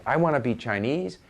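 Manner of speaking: ordinary delivery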